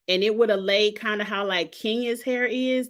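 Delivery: normal talking voice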